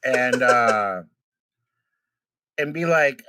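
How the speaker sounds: ordinary talk, nothing marked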